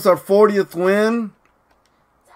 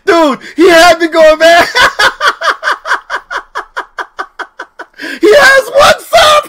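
very high, loud words bursting through laughter; hysterical laughing; 11 semitones higher, 3 dB louder than usual. Real, not acted. Streamer admitting he was fooled, hysterical. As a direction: squeal it out between laughs.

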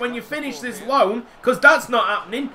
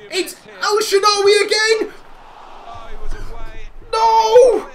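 excited shout; 10 semitones higher than usual. Real, not acted. Gamer watching a late chance, excited shout. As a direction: yell it at the screen in dismay. Last-minute chance, commentating own game.

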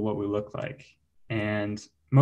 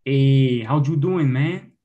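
casual mock Mexican greeting; Mexican accent; 4 dB louder than usual. Shown as a demonstration. Comic greets a man to demonstrate accents, Mexican accent. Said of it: "How about Mexican?".

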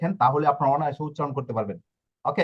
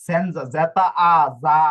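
slow, louder spelling; 6 dB louder, slower than usual. Shown as a demonstration. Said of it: "যা না হয়ে সা হলো কেন".

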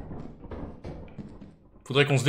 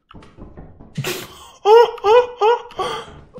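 long high shrieking laugh; cracking up loudly; burst of laughter; 5 dB louder than usual. Real, not acted. Teammate dies in game.

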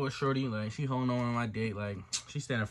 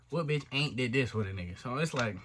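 usual speaking voice

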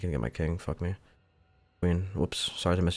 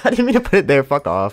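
high, loud laughing protest; giggling disbelief at own mistake; laughing through words; 7 semitones higher, 11 dB louder than usual. Real, not acted. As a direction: laugh while explaining the blunder. Laughing at own misplaced move.

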